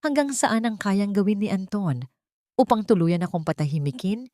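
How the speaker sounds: plain reading voice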